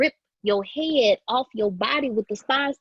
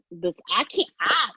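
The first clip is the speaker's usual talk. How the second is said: normal talking voice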